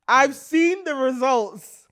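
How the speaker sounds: "I've seen the results" drawn out slow, loud and high; emphatic repeat. Real, not acted. Explaining why she avoids that situation, "I've seen the results" slow and emphatic. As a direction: hammer every word of "I've seen the results".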